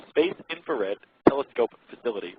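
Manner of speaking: ordinary talk, nothing marked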